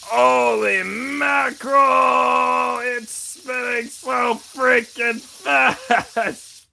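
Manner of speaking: high, loud, drawn-out excited shout; too excited. Real, not acted. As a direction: yell slowly in real amazement, then laugh. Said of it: "I'm getting too excited".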